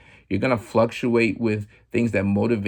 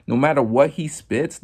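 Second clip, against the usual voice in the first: ordinary talk, nothing marked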